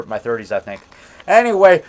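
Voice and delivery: ordinary talk, nothing marked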